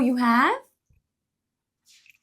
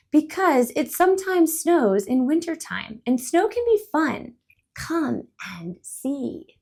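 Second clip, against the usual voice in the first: higher, louder inviting bird; cheerful bird; 6 semitones higher, 4 dB louder than usual. Acted. Cheerful bird invitation.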